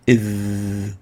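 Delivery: a heavy, throaty "Iv"; ظ مفخمة; emphatic Arabic ظ. Shown as a demonstration. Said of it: "Tip of the tongue and root".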